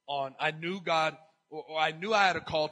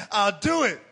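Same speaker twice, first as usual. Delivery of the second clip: usual speaking voice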